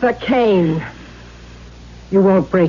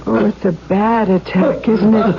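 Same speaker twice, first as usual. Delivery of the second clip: ordinary talk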